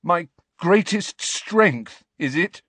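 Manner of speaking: a hint of trembling scorn; voice shaking. A narrator's reading. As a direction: throw the words back, shaking. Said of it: "his voice shaking".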